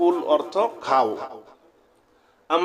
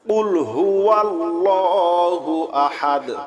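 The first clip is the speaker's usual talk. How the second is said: slow, drawn-out melodic tilawat; Quran recitation; 2 semitones higher, much slower than usual. Acted. Teacher reciting Surah Ikhlas to students, slow melodic chant. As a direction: chant each word long and even, like a qari.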